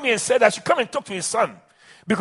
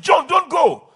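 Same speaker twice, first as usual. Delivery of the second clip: father calling out; 7 semitones higher, slower than usual. Retold or imitated.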